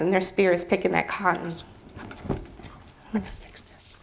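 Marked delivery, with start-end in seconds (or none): 2.3-4.0 a soft whisper while wiping tears; murmured self-reassurance; whispered aside. Real, not acted. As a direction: whisper it to yourself, off mic. Aside while composing herself.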